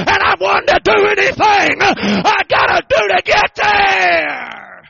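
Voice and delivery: loud, rising, slow-dragged preacher cadence; building to a shouted sermon peak; preaching at full force. Acted. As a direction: hammer it home like a revival preacher. Preaching climax, death story.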